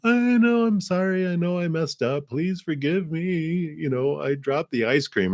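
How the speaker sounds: put-on high, crying child's voice, apologising over and over; sorry grandchild. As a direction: play a little kid who just dropped an ice cream. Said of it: "And they're like".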